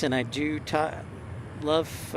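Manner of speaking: ordinary talk, nothing marked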